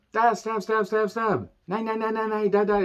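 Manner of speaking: high, hammed-up killer doll stabbing away. Reviewer mimics the killer doll as Esther stabs, mock-manic high chant. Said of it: "It's like the Trilogy of Terror".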